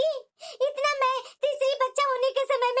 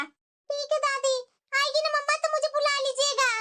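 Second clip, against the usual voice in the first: put-on small child's voice, light and eager; little girl voice. Little girl asking grandma to call her when mom arrives.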